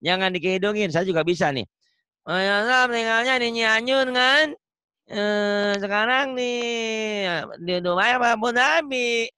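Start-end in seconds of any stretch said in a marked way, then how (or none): 2.3-9.3 nasal demo. Shown as a demonstration. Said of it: "Yang gitu bisa saya juga ke hidung".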